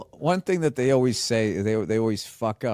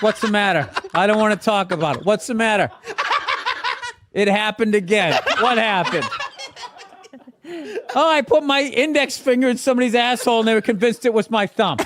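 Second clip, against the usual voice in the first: acted couple dialogue; 10 semitones higher, 4 dB louder than usual. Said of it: "his girlfriend's like".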